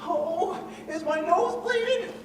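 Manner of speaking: high whimpering complaint; whining crybaby. Acted. Lion whimpering after Dorothy slaps him, high whine. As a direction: whine like a slapped bully.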